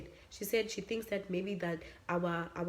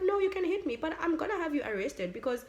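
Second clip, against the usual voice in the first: higher, louder pushback; retold defiant comeback, higher; defiant retort; 7 semitones higher, 4 dB louder than usual. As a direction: stand your ground, pitched up and louder. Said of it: "And I'm like".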